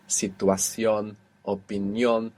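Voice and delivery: "situación, opinión", each stressed on its final syllable; stress on the end. Shown as a demonstration. Teacher listing ion words, last syllable stressed in "situación, opinión". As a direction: slowly land on CIÓN and NIÓN in "situación, opinión". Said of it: "all have their accent on the end".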